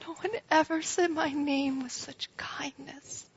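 whispered in awe; hushed whisper. Acted. Mary recalling how Jesus said her name, awed whisper. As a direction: breathe it like a secret.

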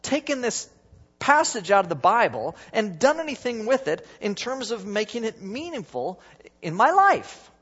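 Wesley amazed. Retold or imitated. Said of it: "He said".

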